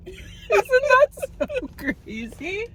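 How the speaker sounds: high, slow, squeaky with laughter; laughing disbelief. Real, not acted. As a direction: gasp it out through laughter.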